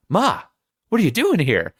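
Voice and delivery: high, strained interrogating son; imitating Harry. Son interrogates mother, high pitch.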